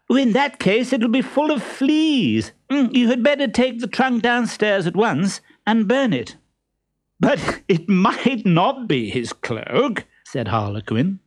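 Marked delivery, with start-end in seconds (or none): 0.1-6.4 high-pitched lady's put-on disdain; mock disdain. A narrator's reading. Columbine taunts the man hidden in the trunk, high mock disdain. 7.2-10.0 slow, high, lightly suppressed amusement; trying not to laugh. A narrator's reading. Harlequin teases the man in the trunk, trying not to laugh. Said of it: "trying not to laugh".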